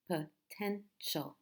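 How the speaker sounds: "Potential" split as po, ten, tial; teacher sounding out "Potential"; syllable by syllable. Shown as a demonstration. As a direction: break "Potential" into three slow, separate beats. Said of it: "Three syllables".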